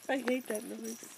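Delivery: ordinary delivery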